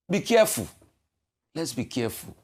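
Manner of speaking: normal talking voice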